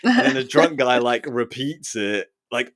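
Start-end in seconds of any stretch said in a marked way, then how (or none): none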